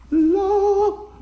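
high, loud, stretched-out cry; acted straining on "love"; strained effort. Believer struggling to keep earning love, strained effort.

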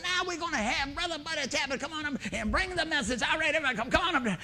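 high, rolling singsong emcee; host introducing a preacher. Retold or imitated. Imitates host introducing him on stage.

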